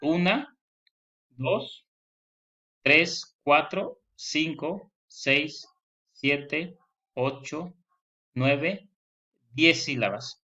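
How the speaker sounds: slow syllable count, one by one; una, dos, tres spaced out like a teacher counting. Shown as a demonstration. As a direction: count each number with a pause. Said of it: "deberíamos contar".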